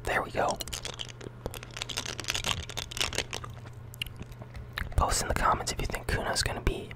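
whispering with a mouthful of jawbreakers; sucking candy. Real, not acted. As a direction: talk around candy in your mouth. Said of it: "I can't even really talk like this".

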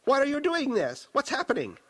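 high, panicked plea; frantic pleading. A narrator's reading. Possessed woman pouring out voices, frantic and pleading. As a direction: cry out in bewildered distress. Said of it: "cursing, pleading".

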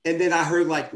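normal talking voice

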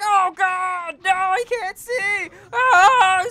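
high, loud hammed-up scream of pain; mock screaming victim. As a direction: ham up a blinded man screaming. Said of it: "Screaming bloody murder".

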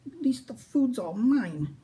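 high, slow sulky grumble; grumbling. Acted. Minosaur grumbles alone over the scones. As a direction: grumble it through a mouthful. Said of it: "he grumbled".

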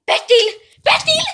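high, urgent calls rising in pitch; panicked calling. Acted. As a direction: call the name with mounting panic. Calls partner in mounting panic. (alltmer panikslaget ropande)

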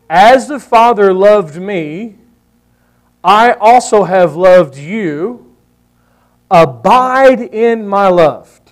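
slow, deliberate reading of the verse; slow scripture reading. Acted. Preacher reading the verse to his church, slow and weighty. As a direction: lay each phrase down slowly for the congregation.

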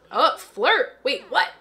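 high loud startled surprise; surprised. Real, not acted. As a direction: react with sudden shock. Surprise at flirt option.

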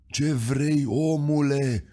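frightened angels. A narrator's reading. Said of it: "întrebau îngerii ascunși de frică".

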